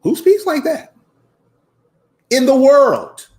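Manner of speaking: high, loud rhetorical challenge; emphatic outburst. Real, not acted. Demanding of listeners who talks like Kwame Brown, high emphatic outburst. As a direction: raise pitch and volume, building to a shout.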